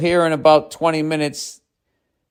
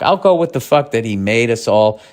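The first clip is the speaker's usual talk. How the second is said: usual speaking voice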